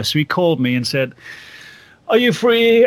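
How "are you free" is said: high, loud put-on English accent; hammed-up English voice on the phone. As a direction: call out in a mock English accent. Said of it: "this is my English accent".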